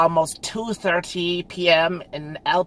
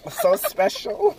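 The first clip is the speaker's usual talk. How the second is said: mock gushing; 12 semitones higher, slower than usual. Joking about breast size.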